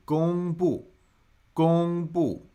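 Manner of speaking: 公布 with high held 公 and falling 布; quiz-style careful modelling of the tones in 公布; tone demonstration. Shown as a demonstration. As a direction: pitch 公 high and level, drop 布 for learners. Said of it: "what tones do we have here?".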